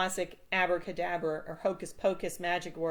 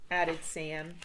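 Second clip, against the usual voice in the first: ordinary delivery, nothing marked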